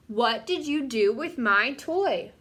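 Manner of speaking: put-on loud, slow, drawn-out yell in a child's voice. Olivia yelling at baby brother William about her toy, loud slow yell. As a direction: bellow it at a little brother over a missing toy. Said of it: "She's yelling again".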